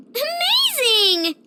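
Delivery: high, drawn-out cry of delight; overjoyed. Acted. Friend offers to split cakes, overjoyed cry. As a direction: stretch it out, soaring with joy.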